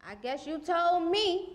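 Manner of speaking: soft, high, sing-song sarcasm; singsong sarcastic surrender; mock concession. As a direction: give in softly with a lilting, rising tease. Mock concession in spoken-word piece.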